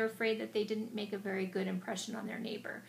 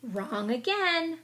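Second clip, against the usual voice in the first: playful teasing; 5 semitones higher, 6 dB louder, much slower than usual. Acted. Mole teasing slowly.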